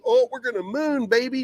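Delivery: high-pitched gushing hype; mock moon-boy excitement. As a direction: gush like an overexcited crypto believer. Mocking hype quote of readers.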